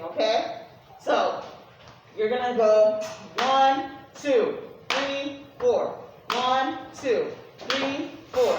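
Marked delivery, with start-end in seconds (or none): 2.2-8.5 even, steady count for the heartbeat group; steady counted beat. Shown as a demonstration. Teacher showing the heartbeat group a steady count. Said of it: "that's what I'm gonna have the heartbeat do".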